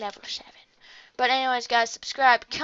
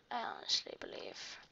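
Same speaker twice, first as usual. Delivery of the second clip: soft lower whisper of hope; 14 dB quieter than usual. Real, not acted. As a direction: breathe it like a secret wish.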